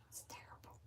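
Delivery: whispered, conspiratorial gossip; whispered gossip about the phone; whispered aside. Real, not acted. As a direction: lean in and whisper the joke. Confiding about Siri's spelling.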